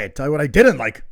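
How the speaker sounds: loud, fast imitated complaint; animated. Host re-enacting co-host's mom, animated outburst. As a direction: blurt it as a lively mock complaint. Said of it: "She was very animated".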